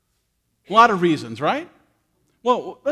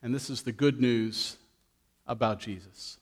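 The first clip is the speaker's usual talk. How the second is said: gentle storyteller reading Luke to the church; scripture reading; 4 semitones lower, 4 dB quieter than usual. A narrator's reading. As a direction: read the story to the congregation. Reads scripture story to congregation.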